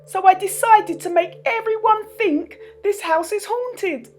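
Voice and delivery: high, meek little man's voice; timid little man. Acted. Mister Bashfellow explains his machines to the kids, high and timid. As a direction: confess shyly.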